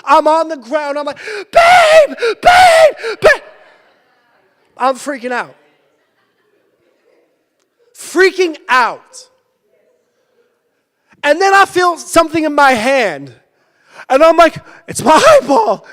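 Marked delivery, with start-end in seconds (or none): 1.5-3.4 high, loud retold yells of babe; panicked calling. Calling wife after eye injury. 14.9-15.9 mock panic. Retold panic over eye.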